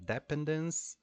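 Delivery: "dependence" stressed DEP-endence, like hospital; mistaken first-syllable stress. Retold or imitated. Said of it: "she came with the pronunciation".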